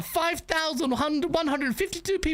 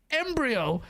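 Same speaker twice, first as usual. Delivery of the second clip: normal talking voice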